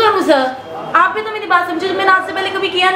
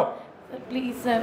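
scared whisper; 5 semitones lower, 15 dB quieter, much slower than usual. Acted. Scared employee pleading with angry boss.

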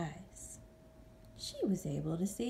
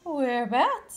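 mother bat; 6 semitones higher, 7 dB louder than usual. Acted. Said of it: "said Mother Bat".

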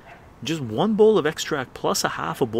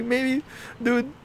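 high teasing voice; 12 semitones higher than usual. Retold or imitated. Teasing friend.

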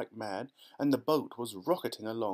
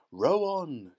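higher, louder order; shouting; 5 semitones higher, 5 dB louder than usual. A narrator's reading. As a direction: cut the question off and urge the rowers. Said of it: "shouted Mister Wonka".

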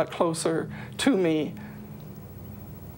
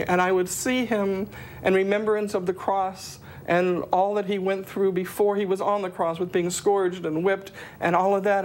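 ordinary talk

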